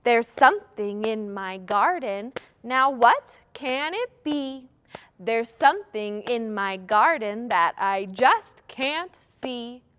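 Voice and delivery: rhythmic, emphatic recital with a lifted question; playful guessing rhyme; children's rhyme. Shown as a demonstration. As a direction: chant it to a steady beat for kids. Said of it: "Let's do one more".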